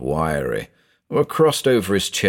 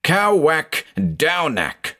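slow, drawn-out higher shouted incantation; sudden yell; 9 semitones higher, much slower than usual. A narrator's reading. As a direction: call out the strange words, stretched. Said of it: "the investigator yelled".

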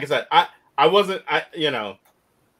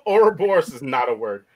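normal talking voice

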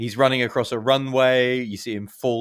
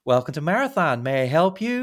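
higher, bright cheerful rental clerk greeting; 8 semitones higher, 4 dB louder than usual. Retold or imitated. Fan imitating the cheerful rental agent greeting a furious customer. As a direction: chirp it like an overly cheery clerk. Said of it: "looks at him cheerfully and says".

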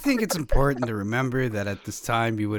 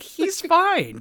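breezy mock reassurance. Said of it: "just being like".

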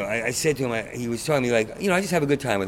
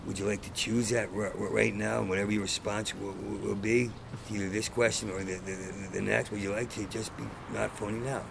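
soft coaxing; 4 semitones lower, 8 dB quieter than usual. Retold or imitated. Gently coaxing the friend to honesty.

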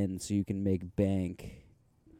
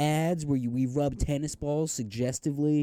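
edgy ad voice; 6 semitones higher, 9 dB louder than usual. Retold or imitated. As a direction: sell it like a cocky ad. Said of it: "edgy approach where they're just like".

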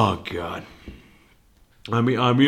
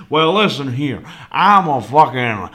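put-on Southern accent; 2 semitones higher, 3 dB louder than usual. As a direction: hold forth like a Southerner. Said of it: "started talking like a southerner".

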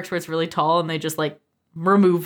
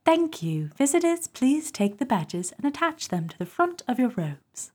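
British accent; 5 semitones higher, 4 dB quieter than usual. Shown as a demonstration. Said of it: "can you do it with, like, a Commonwealth accent".

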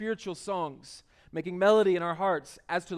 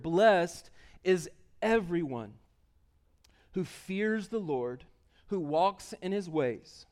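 slow, measured scripture reading; slower than usual. Acted. Preacher reading a psalm to the congregation, slow and measured. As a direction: read it slowly, with deliberate pauses between phrases.

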